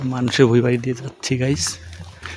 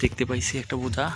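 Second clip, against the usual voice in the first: hushed, sneaking voice; speaking softly; 9 dB quieter than usual. Real, not acted. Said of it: "আস্তে আস্তে করে কথা বলতেছি".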